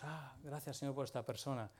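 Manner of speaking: quietly murmured thanks to God; hushed thanks. Acted. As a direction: drop the volume as if praying thankfully. Acted thankful prayer after a testimony.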